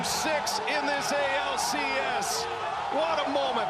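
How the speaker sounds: big excited home run call; excited shout. Real, not acted. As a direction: shout it out over the crowd.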